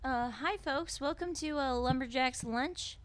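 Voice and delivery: high, chirpy waitress greeting. Acted. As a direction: play a perky diner waitress. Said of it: "am I really doing this".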